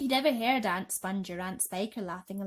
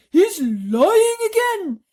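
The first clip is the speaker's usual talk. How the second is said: much higher, louder shriek; 12 semitones higher, 15 dB louder, much slower than usual. Acted. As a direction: shriek the correction. Earthworm shrieking.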